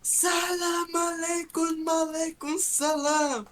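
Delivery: high, slow proclamation; hammed-up royal greeting; mock king's greeting. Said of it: "e começava".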